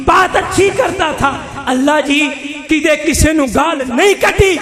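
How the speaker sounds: ordinary delivery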